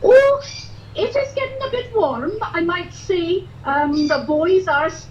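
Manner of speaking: put-on broad Glasgow Gorbals accent; cheeky Glaswegian woman. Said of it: "This is Wee Jenny from the Gorbals".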